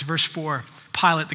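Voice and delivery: ordinary talk, nothing marked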